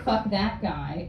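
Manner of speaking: normal talking voice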